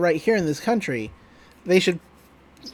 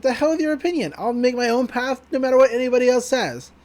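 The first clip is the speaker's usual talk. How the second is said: high-pitched defiant retort; mock-heroic defiance, pitched well up; acted defiance; 6 semitones higher, faster than usual. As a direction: push back in a raised pitch, playing the rebel.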